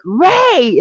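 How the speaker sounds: high yelled name; retold yell. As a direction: call out sharply for help. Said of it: "And I yelled".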